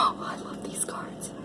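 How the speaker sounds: gasp, then breathe it in a whisper; hushed adoration of the deck; awed whisper. Real, not acted. Reader marveling at her beautiful cards, soft awed whisper. Gushing over tarot cards.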